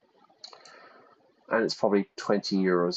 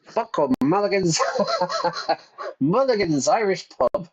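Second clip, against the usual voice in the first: excited; 9 semitones higher than usual. Real, not acted. Finds the pub he wanted.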